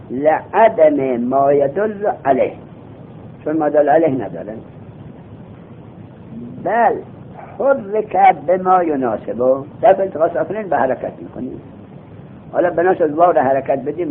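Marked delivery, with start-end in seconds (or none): 0.1-2.5 slow recitation. Shown as a demonstration. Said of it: "slow recitation of text". 6.6-9.5 slow recitation. Shown as a demonstration. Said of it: "slow recitation of text".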